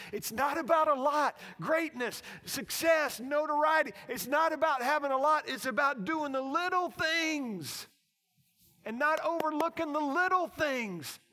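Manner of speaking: loud, high, ringing preacher's proclamation; full-force preaching. Real, not acted. Preacher telling congregation what greatness is, at full force. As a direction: drive each phrase home loudly.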